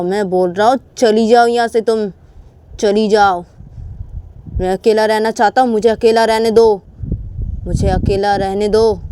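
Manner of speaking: heated go-away outburst; angry rejection. Acted. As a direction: order her away, hurt and insistent. Acted quarrel, Sanju rejects Uma.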